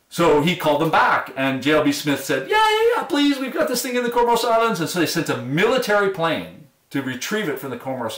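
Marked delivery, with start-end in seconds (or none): 2.5-4.8 very high imitated begging, easing down slightly; hammed-up excited phone plea; eager pleading. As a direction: jump in desperately, pitch way up, mock-frantic. Acting out Smith's desperate plea by phone.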